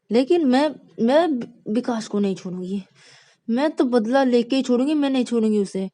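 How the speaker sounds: loud, high petulant rage; angry girl. Acted. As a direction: fume like a wronged younger sister. Rani vows revenge.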